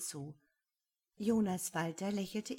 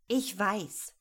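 louder, mildly snippy reply; pert retort; 5 dB louder than usual. A narrator's reading. Rosemarie answers Paule's patient explanation, a little snippily. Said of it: "entgegnete Rosemarie schnippisch".

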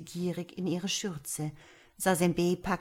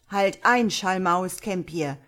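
loud commanding shout; calling out; 7 dB louder than usual. A narrator's reading. Boy stopping the witch at the door, loud call. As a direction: call out to stop her. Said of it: "rief der Junge".

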